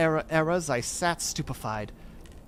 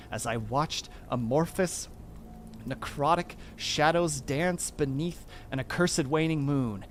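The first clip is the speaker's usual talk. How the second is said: plain reading voice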